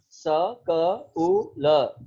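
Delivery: school split into separate sounds स, क, उ, ल; isolated sounds स, क, उ, ल, each released alone. Shown as a demonstration. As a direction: sound out स... क... उ... ल one at a time, with pauses. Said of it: "For example, in school".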